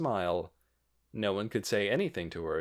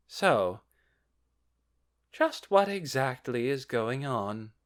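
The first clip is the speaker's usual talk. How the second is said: cool lady voice; 3 semitones higher, slower than usual. A narrator's reading. Solution asks.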